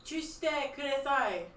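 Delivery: loud shouted warning in Japanese. Acted. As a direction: call out to the whole party. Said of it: "he shouts for everybody".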